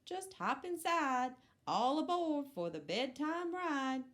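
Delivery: cheerful truck voice. Acted. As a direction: call everyone aboard like a happy little truck. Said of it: "said Blue".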